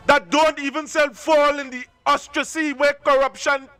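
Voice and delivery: normal talking voice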